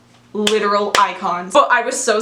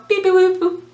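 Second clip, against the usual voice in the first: phone dial beeps; 9 semitones higher than usual. Retold or imitated. Imitating phone dialing tones.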